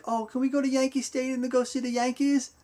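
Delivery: put-on high, childlike little-boy voice; little son's voice. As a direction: imitate a small boy asking hopefully. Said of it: "And Peter's like".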